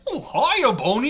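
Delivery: high, cheery cartoon greeting; squeaky cartoon mascot saying hello; Mickey Mouse. Character greets the narrator at the door, cartoon mouse voice. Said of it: "read that in a Mickey Mouse voice".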